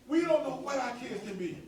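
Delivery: ordinary talk, nothing marked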